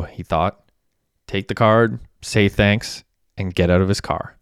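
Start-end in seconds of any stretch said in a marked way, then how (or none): none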